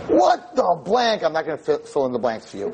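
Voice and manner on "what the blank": high, slow, hostile jeer; snarling teen girl. Retold or imitated. Pierced teen girl taunts the rabbi, high and hostile. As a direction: play the mouthy girl sneering at the rabbi. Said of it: "she says".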